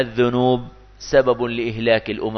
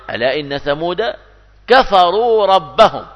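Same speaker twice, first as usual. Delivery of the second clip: Quran recitation; 3 semitones higher, 4 dB louder than usual. Acted. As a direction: intone it like tajweed, stretching كفروا. Reciting Quran verse in sermon.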